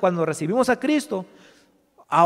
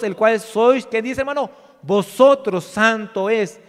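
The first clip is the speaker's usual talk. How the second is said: loud, higher preaching voice; forceful scripture reading; 3 semitones higher, 8 dB louder than usual. Real, not acted. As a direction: proclaim the verse to the congregation.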